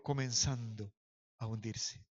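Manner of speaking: low, very soft, deliberate phrase; hushed emphasis. Shown as a demonstration. Preacher has the church repeat the verse, hushed.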